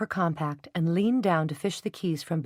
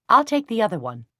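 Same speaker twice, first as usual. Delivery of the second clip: prim hauteur; 4 semitones higher, 7 dB louder than usual. A narrator's reading. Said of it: "she said with prim hauteur".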